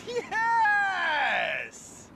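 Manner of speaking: high yelled sweeping calls; curling skip yelling. Acted. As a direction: shout curl and hurry hard.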